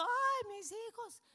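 high-pitched wail of a weeping parent; mock crying. Preacher imitates parents crying over grown children, mock wailing. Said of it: "Y luego estamos llorando".

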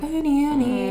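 soothing owner calling her dog's name. Retold or imitated. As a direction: coax the dog to settle. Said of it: "And I'm like".

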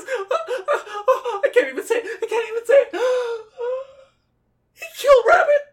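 gasping, stammering little pig; stammer, gasp, then blurt it out high; distraught Piglet. Acted. Piglet breaks the news of Rabbit's death, distraught.

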